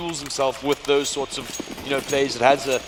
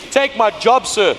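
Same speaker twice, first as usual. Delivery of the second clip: playful shout; 10 semitones higher, 9 dB louder than usual. Real, not acted. Joking at fan on stage.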